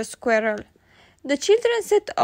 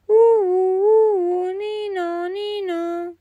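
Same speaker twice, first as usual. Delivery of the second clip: siren sound; 5 semitones higher, 4 dB louder, slower than usual. Acted. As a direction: wail it like a police siren. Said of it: "It goes like this".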